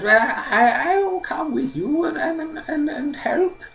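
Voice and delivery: very high, loud, stammering elder; old man, offering help. Acted. Old man offers to join Simpleton, high and halting. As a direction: stumble over the words in a high old-man voice.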